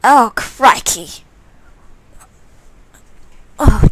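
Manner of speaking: normal talking voice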